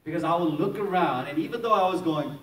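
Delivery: ordinary delivery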